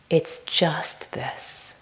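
a slow, hushed ending; whispered and unhurried. Real, not acted. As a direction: let the words fade into stillness.

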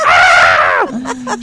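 loud gleeful yelp; mock gleeful shriek; gleeful shout. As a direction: shout with delight. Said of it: "she's like".

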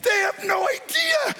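yard-sale bargain hunter hollering with joy; giddy excitement. Pretend. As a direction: shout like you got away with a steal. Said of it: "You're like".

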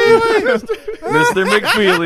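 sing-song Mister McFeely call. Retold or imitated. Imitating the TV mailman greeting.

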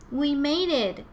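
high, glad storybook exclamation; child's excited cry, acted. A narrator's reading. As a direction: exclaim it in triumph. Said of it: "Ava exclaimed".

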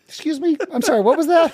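high, incredulous and laughing; stunned, half-laughing excuse me; mock disbelief. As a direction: pretend you misheard. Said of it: "And I went".

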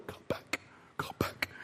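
barely voiced, very soft cry for help; weak whispered plea; faint whispered call. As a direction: call out with no voice left, almost a whisper. Acting stranded survivor's faint calls.

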